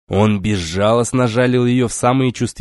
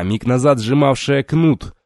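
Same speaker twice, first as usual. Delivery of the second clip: plain reading voice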